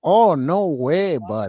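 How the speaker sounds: slow, stretched Canadian "no way, bud"; Canadian accent. Retold or imitated. Mocking the Canadian video guys in a slow Canadian accent. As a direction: ham up a Canadian reacting to the video.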